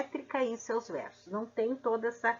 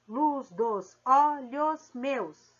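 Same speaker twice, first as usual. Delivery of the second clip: slow, steady read of the line; much slower than usual. Shown as a demonstration. Teacher reads the verse again, slow and even. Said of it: "Vamos fazer a separação poética ou métrica".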